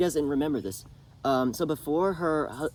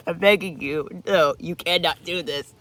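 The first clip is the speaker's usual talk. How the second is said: loud begging; 4 semitones higher, 7 dB louder than usual. Retold or imitated. Mother begging her son.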